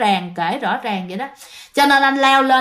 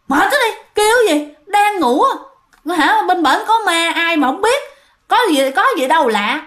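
groggy neighbour; 6 semitones higher than usual. Retold or imitated. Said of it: "ảnh đang ngái ngủ các bạn".

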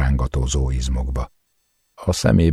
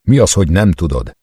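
angry demand; 4 semitones higher, 5 dB louder than usual. A narrator's reading. Furious interrogation of friend.

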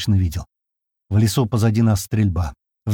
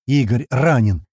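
ordinary delivery, nothing marked

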